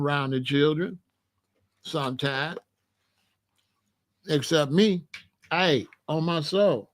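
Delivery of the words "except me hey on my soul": usual speaking voice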